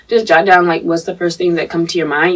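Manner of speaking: ordinary talk, nothing marked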